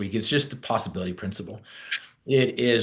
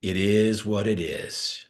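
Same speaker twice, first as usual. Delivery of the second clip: slow shrug; slower than usual. Retold or imitated. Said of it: "And he's like".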